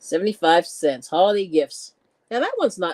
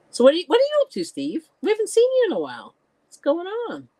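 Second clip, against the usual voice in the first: much higher, quick and eager; excited greeting; 12 semitones higher than usual. Real, not acted. Host greets returning viewer Steve, excited and fast.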